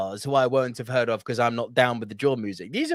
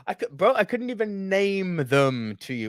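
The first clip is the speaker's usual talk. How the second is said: ordinary talk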